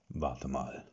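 low, gloomy murmur; a dark, hushed warning; düstere Stimme. A narrator's reading. Old companion warns the hero, gloomy voice. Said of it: "sagte er mit seiner düsteren Stimme".

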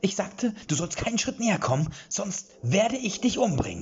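high, loud threat, slowing at the end; threatening. A narrator's reading. Fighter threatening Erik, high loud menacing shout. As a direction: menace him with every word.

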